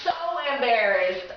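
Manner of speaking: high, dramatic wail of embarrassment; hammed-up mortification; mock mortified. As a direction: overplay the shame. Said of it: "I was like".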